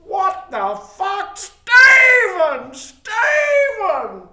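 high, loud terrified shout of a name; panicked yelling. Retold or imitated. Ray yelling at Steve in terror, loud panicked shout. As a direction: scream it in panic, drawn out. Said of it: "he's just yelling out".

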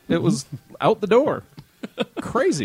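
high, laughing disbelief. Real, not acted. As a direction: laugh through the words. Laughs at own misfortune.

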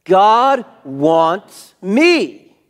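"God wants me" shouted high, every word drawn out; emphatic repeat. Shown as a demonstration. Preacher driving congregation to repeat "God wants me", emphatic shout. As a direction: hammer out "God wants me" slowly and loudly. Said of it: "Say it again".

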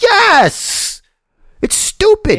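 very high-pitched indignant cry; indignant, voice leaping way up; exasperated outburst. Real, not acted. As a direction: burst out in exasperation. Heated rant at co-host.

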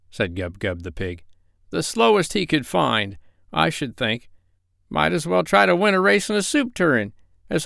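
high-pitched grumbling pig; high, put-on whiny pig; complaining Gub-Gub. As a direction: moan about the ship in a high character voice.

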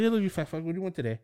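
rattled off high and quick; super quick. Retold or imitated. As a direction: slip the insult in fast and bright. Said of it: "Like, super quick".

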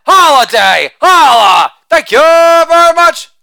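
loud sing-song holiday chant; shouted catchphrase. Acted. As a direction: belt the tag line like a party cheer. Catchphrase chant after each joke.

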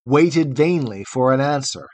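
usual speaking voice

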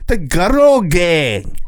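put-on drawn-out high English accent on The Girl Gang; slow, exaggerated English accent; hammed-up English accent. Shown as a demonstration. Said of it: "really go into the accent, an English accent".

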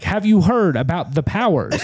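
high, slow, swooping rapper impression. Rapper raving to friends at night, high slow impression. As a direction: draw it out high, like a rambling late-night mystic. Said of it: "not a bad [name]".

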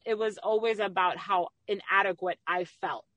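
ordinary delivery

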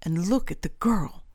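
ordinary delivery, nothing marked

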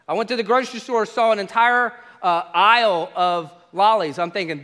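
ordinary delivery

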